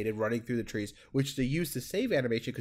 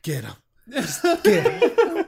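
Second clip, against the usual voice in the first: squeaky laughing fake sob; mock crying; 8 dB louder than usual. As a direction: sob the line through laughter. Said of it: "He's, like, crying".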